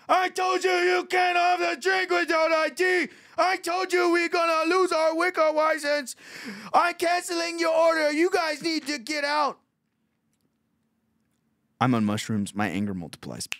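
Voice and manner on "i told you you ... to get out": very high, strained caricature shout; angry mock waiter. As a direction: imitate an outraged waiter yelling at customers. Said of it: "He goes".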